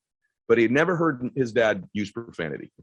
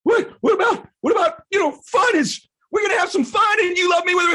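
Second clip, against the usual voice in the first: put-on much higher, louder, shouted kid voice; a kid shouting back at his angry dad; son pleading; 6 dB louder than usual. Son shouting back at his dad about having fun, high and loud. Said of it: "He's like".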